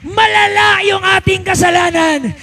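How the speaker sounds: high, loud shouted sermon over music; full-force preaching. Acted. Preacher driving home God's forgiveness, shouting high. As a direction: preach it to the rafters, slow and loud.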